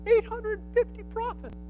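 high, soft squeak of amazement; hushed high-pitched disbelief at the number; amazed aside. Real, not acted. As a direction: marvel in a small high voice.

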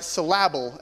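"syllable" said suh-LAB-ul, middle syllable stressed; misplaced syllable stress. Shown as a demonstration. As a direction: jokingly stress the second syllable of "syllable". Joke stress on wrong syllable.

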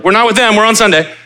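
hammed-up panicky church voice; mock hasty disowning. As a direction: shout it out like a nervous crowd backing away. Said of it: "they were like".

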